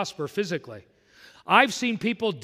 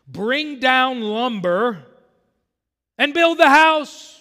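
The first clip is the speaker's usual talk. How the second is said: slow, much louder and higher, each word hammered; booming preacher shout; 7 semitones higher, 9 dB louder, much slower than usual. Acted. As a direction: thunder God's command at the congregation. Preacher voicing God's command.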